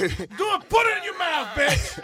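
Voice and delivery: high, loud cop yell; mock cop yell. Cop screaming at the driver over the breathalyzer. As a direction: bark it like a furious cop.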